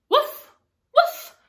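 high, loud little puppy woofs; dog barks. Acted. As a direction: bark it like an excited puppy. Dog barks in picture book.

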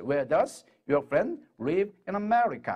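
very slow, drawn-out English; slow demo. Shown as a demonstration. Teacher modelling the English sentence, very slow. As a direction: stretch every word out for learners. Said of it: "하고 말하면 돼요".

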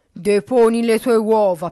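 loud, booming order; commanding ogre. A narrator's reading. Ogre orders the hen to lay, loud and commanding. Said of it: "che comandava ad una gallina".

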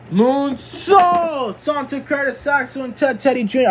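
wrestling commentary leaping high on each big move; excited play-by-play. Pretend. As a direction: build from uh-oh to a high cry.

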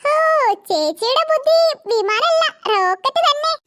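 usual speaking voice

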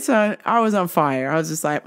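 ordinary talk

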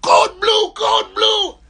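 very high, loud urgent call; shouted alarm. Retold or imitated. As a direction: bark the alarm over the radio. Officers shouting into radio.

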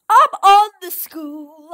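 loud slow shout, stretched out; excited shout. Real, not acted. Gamer glitched onto the school roof, excited shout. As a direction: yell it with disbelieving joy.

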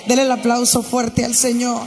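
loud, rousing call to the church; preaching at full force. Acted.

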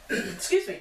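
ordinary talk

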